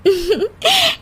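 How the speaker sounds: short happy chuckle; light laugh. Acted. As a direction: laugh with relief. Happy ending, she laughs. (हल्की खुश हँसी)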